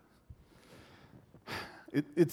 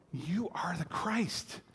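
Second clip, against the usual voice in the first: a slow reverent whisper of discovery; whispered revelation; awed whisper; 4 semitones higher, 5 dB quieter, slower than usual. Retold or imitated. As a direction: breathe it out in a hushed whisper. Peter's awed realization.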